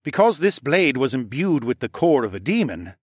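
animated expert's warning; Falcon Man, explaining gravely. Acted. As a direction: lay out the rule emphatically.

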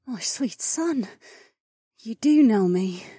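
high, slow, breathy mother; tender reassurance. Acted. Dying mother comforting her son, tender and slow. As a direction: soothe him slowly and lovingly.

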